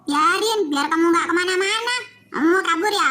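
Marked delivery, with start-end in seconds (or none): none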